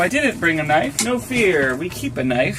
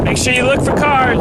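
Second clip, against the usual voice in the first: calling out; 8 semitones higher, 6 dB louder than usual. Real, not acted. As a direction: call it across the parking lot. Dad calls to child outside.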